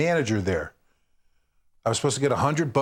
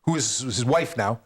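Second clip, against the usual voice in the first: usual speaking voice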